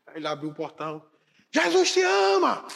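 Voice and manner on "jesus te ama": drawn-out shout, much higher and louder. Acted. Man obeying the Spirit at 3am, shouting slowly. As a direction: yell it slowly out to the empty street. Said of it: "dê um grito bem alto".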